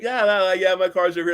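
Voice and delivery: high, bright, casual lure; playful high come-on; breezy eager reassurance. Retold or imitated. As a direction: toss it off eagerly to a date. Acting herself luring a date.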